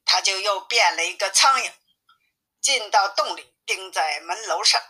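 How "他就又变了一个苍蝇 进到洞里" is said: ordinary delivery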